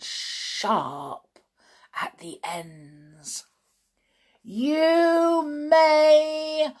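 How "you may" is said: high, loud, slow witch's bark; barked command. A narrator's reading. Grand High Witch ordering the witches, slow high bark. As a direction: drag out each word like a cruel queen. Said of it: "barked the Grand High Witch".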